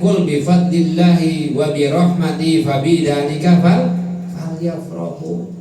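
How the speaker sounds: chanted Arabic verse, rising loud; Quranic recitation. Acted. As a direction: recite it like tilawah. (lantunan ayat Al-Quran)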